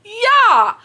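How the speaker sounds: excited yeah. Real, not acted. Answering own outraged question.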